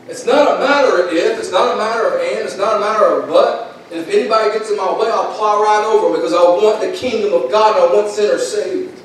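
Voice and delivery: rising, insistent preacher's cadence; fervent preaching. Acted. Preacher rallies the church with rising insistence. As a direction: hammer home the point to the congregation. Preacher builds to full force.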